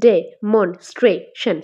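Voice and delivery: very slow ডে - মন - স্ট্রে - শন; demonstration in four drawn-apart beats; syllable by syllable. Shown as a demonstration. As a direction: sound out demonstration, long gaps between syllables. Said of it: "কোথায় আমাদের প্রেশারটা পড়ছে?".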